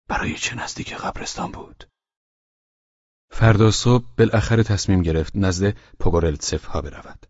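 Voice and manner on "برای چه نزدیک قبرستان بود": soft, hushed inner question; thinking to himself. A narrator's reading. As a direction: murmur it as a private thought. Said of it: "فکر کرد". (آهسته، مثل فکری در دل)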